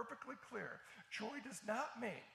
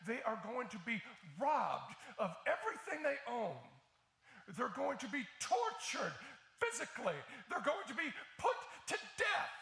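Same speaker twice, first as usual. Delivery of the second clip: much higher and louder, near shouting; climactic preaching; 4 semitones higher, 10 dB louder than usual. Real, not acted. Pastor shouting nothing can take their joy, climactic preaching. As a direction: build to a roaring crescendo.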